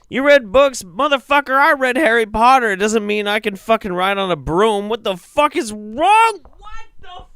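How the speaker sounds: high, loud outraged yelling; shrieking at the guy's books; incredulous shriek. Real, not acted. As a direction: scream it in disbelief, way up high. Outraged at urine therapy books.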